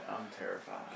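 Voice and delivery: hushed whisper of fear. Pretend. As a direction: whisper it, scared.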